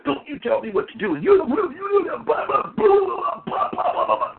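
high-pitched blustering rant dissolving into blah-blah; mock angry tirade. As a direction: imitate someone lighting into a judge, all high babble. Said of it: "he lit into that judge".

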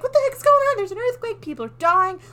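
high, loud panicked cry; freaked out. Retold or imitated. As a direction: squeal it like the ground is splitting open. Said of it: "Fiora's all freaked out".

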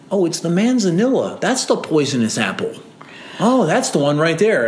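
lightly voiced eager lady pointing it out; imitating the lady. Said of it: "this lady's like".

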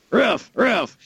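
high mock robot barking. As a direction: bark it out in a high, twangy robot voice. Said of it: "why does my dog sound like L?".

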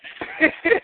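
short high laugh. Real, not acted. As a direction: let out a quick, high laugh. Laughs at the insult.

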